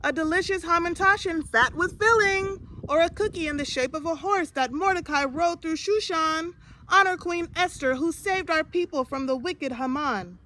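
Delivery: high, loud vendor's pitch; calling out. A narrator's reading. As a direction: keep crying wares over the crowd. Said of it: "Basha called".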